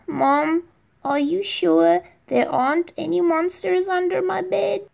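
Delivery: put-on a scared kid stalling bedtime; little boy asking his mother about monsters at night; anxious little boy. Child asking mom at bedtime.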